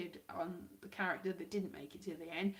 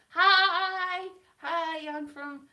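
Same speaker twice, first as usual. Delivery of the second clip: high, loud, drawn-out greeting; mock character greeting, starting big then falling away; botched Angel impression; 7 semitones higher, 11 dB louder, much slower than usual. Vlogger slipping from one impression into another, high mock greeting. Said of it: "that's Angel. I've turned into Angel".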